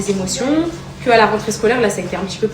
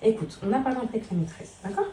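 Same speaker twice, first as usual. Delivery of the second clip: calm him so he listens; gentle mother talk; 10 dB quieter than usual. Real, not acted. Mother talks softly to her son about school.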